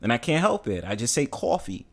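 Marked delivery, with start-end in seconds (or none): 1.2-1.8 "coffee" as "caw-fee"; New York pronunciation. Shown as a demonstration. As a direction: round the first vowel of "coffee" into "aw". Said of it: "get on my ass when I say coffee".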